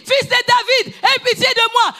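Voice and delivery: high shouted plea; crying out. Acted. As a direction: cry out for help. (cri suppliant et aigu)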